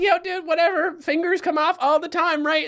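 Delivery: high, breezy shrug of a voice; nonchalant. Retold or imitated. His toddler self shrugging off the lost finger, nonchalant. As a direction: toss it off like nothing. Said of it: "I'm like".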